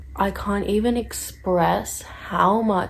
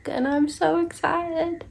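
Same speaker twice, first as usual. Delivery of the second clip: high, giddy excitement; excited; 9 semitones higher than usual. Real, not acted.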